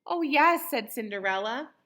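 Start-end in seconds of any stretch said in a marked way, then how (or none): 0.1-0.7 high, loud burst of eagerness; bright-eyed wish blurted out loud; eager yes. A narrator's reading. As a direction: jump at the offer. Cinderella eagerly answers the tease.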